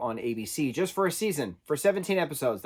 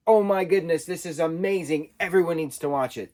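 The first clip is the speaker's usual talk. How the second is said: louder, higher gushing fan; mock raving; 5 semitones higher, 5 dB louder than usual. Imagined fan raves about a truly great movie, mock-excited. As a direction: gush as if raving about a film. Quotes a raving fan's reaction.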